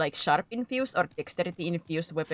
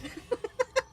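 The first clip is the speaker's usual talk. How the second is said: high giggle. Real, not acted. Laughing mid bit.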